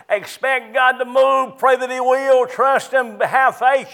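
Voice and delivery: high, ringing pulpit exhortation; fervent preaching. Acted. Preacher pleading with his congregation, fervent and high. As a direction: preach it with rising fervor.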